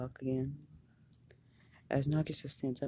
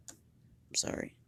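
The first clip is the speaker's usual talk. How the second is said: soft, slow, breathy whispered apology; hushed apology; 6 dB quieter, much slower than usual. Acted. Teacher whispering a slow sorry to Karma. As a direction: whisper the sorry slowly, barely voiced.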